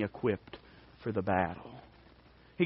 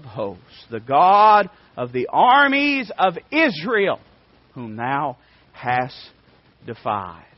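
slow KJV reading with loud peaks; scripture reading; 2 semitones higher, 7 dB louder, slower than usual. Acted. Preacher reading David's words to the Philistine, slow and booming. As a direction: preach the verse out, word by word.

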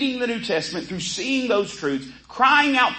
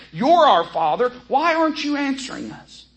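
pleading remnant; 6 semitones higher than usual. Retold or imitated. Voicing the remnant's plea to God.

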